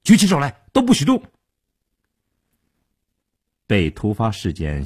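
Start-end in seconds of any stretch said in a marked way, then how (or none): 0.0-1.2 high-pitched shouted robber's command; shouting. A narrator's reading. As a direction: shout it like an armed robber ordering everyone still. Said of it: "大喊道".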